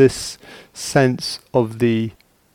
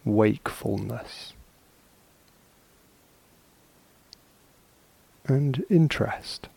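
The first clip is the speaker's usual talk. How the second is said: slow, soft words with long silences between; calm, spacious meditation guidance; guided meditation; 4 dB quieter, slower than usual. Acted. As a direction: ease listeners into stillness, one unhurried phrase at a time.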